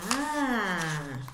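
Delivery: ordinary delivery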